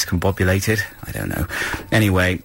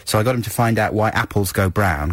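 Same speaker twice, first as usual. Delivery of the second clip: ordinary talk, nothing marked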